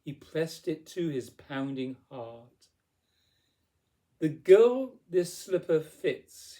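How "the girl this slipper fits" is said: loud, slow proclamation, pitched up; raised, ringing storybook declaration; crying out. A narrator's reading. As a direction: declare it like a grand vow. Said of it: "he cried".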